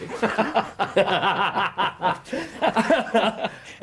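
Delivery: a long, full laugh of delight; amused chuckle that rolls on; hearty laugh. Real, not acted. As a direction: laugh openly at your friend. Holmes laughs at Watson.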